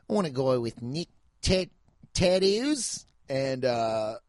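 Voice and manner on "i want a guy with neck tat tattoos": halting mock Aussie accent, rising at the end; Australian accent. Imitating contestants who want neck tattoos, in an Australian accent. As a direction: struggle through it in a put-on Australian accent. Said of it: "They're saying neck".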